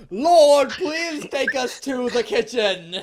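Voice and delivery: very loud, high, drawn-out plea; bellowing. A narrator's reading. Headmaster calling on the Lord, mock bellow. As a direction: bellow it to the heavens, hammed-up. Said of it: "bellowed in a voice even louder".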